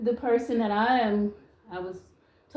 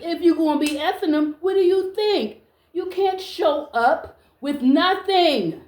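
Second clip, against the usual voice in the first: indignant scolding; 5 semitones higher, 3 dB louder than usual. Real, not acted. Woman telling off men who show up with nothing, indignant. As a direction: demand an answer from a useless man.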